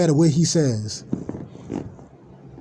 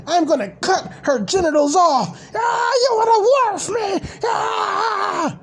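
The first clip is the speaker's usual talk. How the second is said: very high crying shout; hammed-up sobbing yell of the streamer losing it; mock tantrum; slower than usual. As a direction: wail like a throwing-a-fit toddler. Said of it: "They're all talking to him in the chat".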